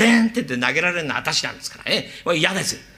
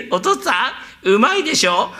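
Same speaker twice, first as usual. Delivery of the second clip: the son's voice put on, a touch louder and higher; mimicking the son; 7 semitones higher, 4 dB louder than usual. Servant imitating the son to fool the father. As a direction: pass for the son calling home.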